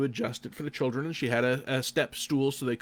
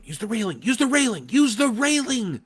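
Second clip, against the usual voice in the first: imitating background shouting; 10 semitones higher, 6 dB louder than usual. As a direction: imitate a sister calling out urgently from behind the microphone. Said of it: "in the background of the microphone".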